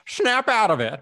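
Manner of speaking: loud, high diva impression. Shown as a demonstration. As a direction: belt it out as a pop diva. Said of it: "can I even do a [name] impression?".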